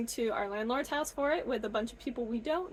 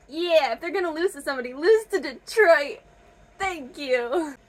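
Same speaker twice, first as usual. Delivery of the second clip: mock cheering; 3 semitones higher, 6 dB louder than usual. Retells own cheering reaction to team winning.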